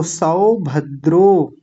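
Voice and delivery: सौभद्रो very slowly, सौ open and द्रो closed; slow teacher demo; slow syllables. Shown as a demonstration. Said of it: "ध्यान रखिएगा".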